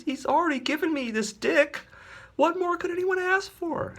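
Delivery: a choked, pleading superhero; Dick's earnest voice. Acted. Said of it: "Dick says".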